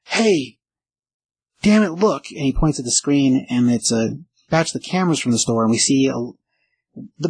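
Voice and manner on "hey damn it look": mild mock yell, pitched high; retold yell. Shop owner spots a thief on camera, mock yelling. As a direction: call out higher without really shouting. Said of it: "we hear Otto yell".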